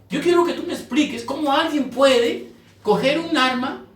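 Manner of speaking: rising, pressing challenge; fervent preaching. Acted. Preacher challenges the congregation, rising and fervent. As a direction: press the church hard with each question.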